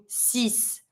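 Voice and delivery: six said the French way, "sees". Shown as a demonstration. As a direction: end six on an s sound, no ks. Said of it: "pronouncing it like an S".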